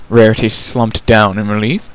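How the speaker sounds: ordinary delivery, nothing marked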